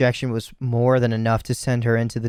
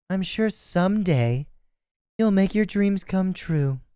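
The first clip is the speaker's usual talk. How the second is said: put-on high sweet girl voice; 8 semitones higher, slower than usual. Junko's sweet fake farewell.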